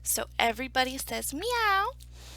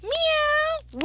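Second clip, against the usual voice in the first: high, loud, drawn-out meow; cat's meow; 7 dB louder than usual. Acted. Storyteller leading everybody in the story's meow, high and loud. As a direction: belt out a long high meow. Story chorus of animal sounds.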